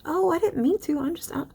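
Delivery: teacher mimicking the poet's feigned humility; mock ditzy apology. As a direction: play dumb, sweetly apologetic. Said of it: "she'll be like".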